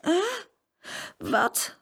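drowsy questioning, starting high; half-awake little questions; sleepy. A narrator's reading. Girl woken by her brother, sleepy. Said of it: "vroeg Saskia slaperig".

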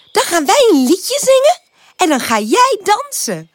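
high, cheery tiny voice; delighted little flowers. A narrator's reading. Tulips to Pip, planning songs and dancing, delighted. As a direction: propose it in an excited flower voice.

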